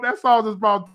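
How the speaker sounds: ordinary delivery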